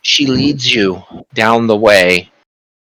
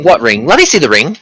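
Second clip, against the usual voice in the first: eager sorcerer; 6 semitones higher, faster than usual. Acted. Sorcerer demands the ring.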